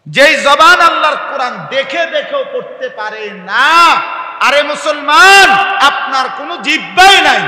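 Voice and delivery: high, shouted sermon rebuke; thundering preacher, stretched words at high pitch; full-force preaching. Real, not acted. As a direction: cry out to the congregation in reproach.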